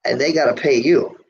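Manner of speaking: normal talking voice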